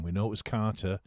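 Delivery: ordinary talk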